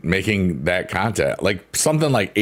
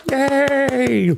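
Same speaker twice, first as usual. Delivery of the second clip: excited cheer. Real, not acted. Cheering as emotes appear.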